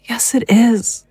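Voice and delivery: whispered, guilt-ridden insistence. Acted. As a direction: barely breathe it out, sure of her guilt. Distraught mother rejects comfort.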